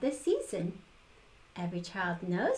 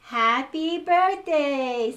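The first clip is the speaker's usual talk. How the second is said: loud, slow, high snowman greeting; booming first words of a snowman; slow loud happy birthday; 6 semitones higher, 10 dB louder, much slower than usual. A narrator's reading. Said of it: "said the snowman".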